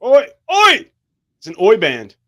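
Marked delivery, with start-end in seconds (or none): 0.0-0.9 put-on Irish accent. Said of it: "an Irish accent ... talks like this".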